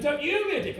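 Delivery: high-pitched swoop on "you"; bickering, stress on YOU. Acted. Back-and-forth row with dad.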